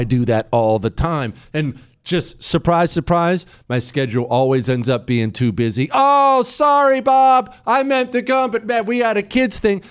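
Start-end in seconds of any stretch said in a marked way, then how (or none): 5.9-9.8 fake apologetic excuse. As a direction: sound sorry but not mean it. Acted excuse for declining an invite.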